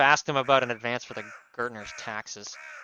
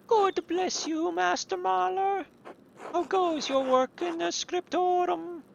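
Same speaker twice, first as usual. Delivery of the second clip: high, slow nun voice; old nun voice; slower than usual. Acted. Elderly sister greeting the artist, high and slow. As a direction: bless him in a high, drawn-out voice. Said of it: "these fucking wild accents".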